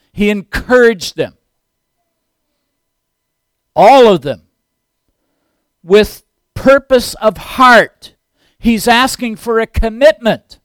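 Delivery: loud, high, slowly measured pulpit delivery; emphatic preaching. Real, not acted. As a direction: stretch out each phrase for the congregation.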